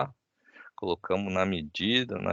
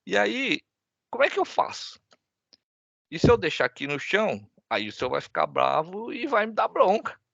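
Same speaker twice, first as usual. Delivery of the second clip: put-on much higher, tearful child's voice; crying little girl; 13 semitones higher than usual. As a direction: play the small daughter close to tears. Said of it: "E aí a menina chorando, fala".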